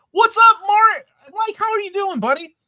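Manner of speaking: loud, high, gleeful shout-out; excited greeting. Real, not acted. Host welcomes a guest with a loud, high, excited shout.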